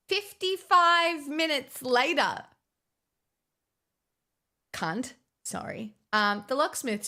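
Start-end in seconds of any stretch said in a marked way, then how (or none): 0.1-2.4 slow, higher announcer voice for the time skip; mock narrator. Dramatic time-skip caption voice. 5.4-5.9 a breathy whispered apology; whispered sorry. Real, not acted. Apology after swearing.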